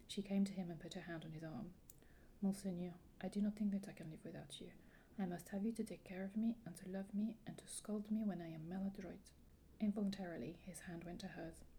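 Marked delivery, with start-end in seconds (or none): 2.4-9.3 French-accented pleading, lightly acted. Heroine begs the Duke to keep her, French accent. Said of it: "I really enjoyed your French accent".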